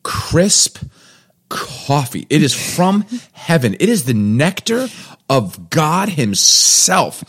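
slow, high, swooning over coffee; gushing praise. Acted. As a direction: savor every word like a food critic.

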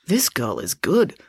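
ordinary delivery, nothing marked

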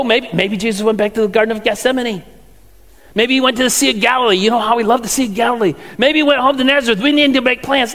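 fast, eager rattling off of ideas; rambling guesses. Retold or imitated. Preacher imagining the disciples' guesses, fast and eager. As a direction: hurry through the maybes.

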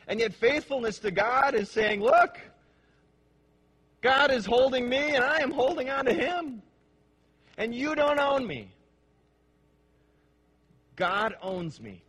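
loud, high, ringing pulpit voice; full-force preaching. Acted. Preacher to congregation on holding on to God, fervent. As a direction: proclaim it to the whole congregation.

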